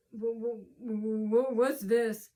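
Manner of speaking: halting, drawn-out mocking demand; imitated snarl. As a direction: imitate a snarling clerk stumbling on "what". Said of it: "she's snarling".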